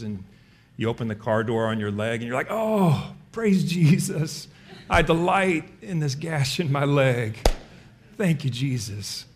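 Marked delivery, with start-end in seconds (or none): 2.5-9.3 high, slow, hammed-up saintly praise; drawn-out falsely holy thanks through pain; mock pious joy. Preacher mocking a believer praising God for a gashed leg, hammed-up saintly voice.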